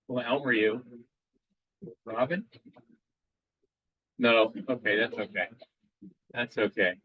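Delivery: ordinary delivery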